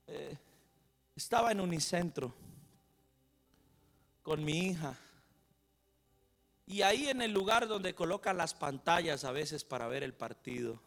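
normal talking voice

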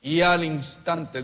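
y al instante stretched slowly and loudly, words set apart; slow, loud preacher's emphasis on y al instante; slow emphatic repeat. Shown as a demonstration. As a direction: drag out y al instante so the church can echo it. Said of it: "diga conmigo".

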